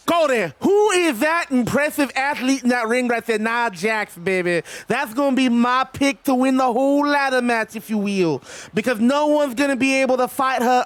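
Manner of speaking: drawling wrestling-legend promo voice; Dusty Rhodes. Acted. Streamer picks Nia Jax for the ladder match, wrestling-legend impression. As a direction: impersonate an old wrestler cutting a promo. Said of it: "I will do a Dusty Rhodes voice".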